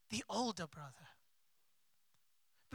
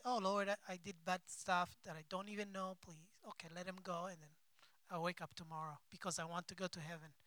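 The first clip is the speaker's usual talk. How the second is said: softer, fast careless mumble; mock lazy prayer; 4 semitones lower, 10 dB quieter, faster than usual. Preacher mocking a careless nighttime prayer, soft fast mumble.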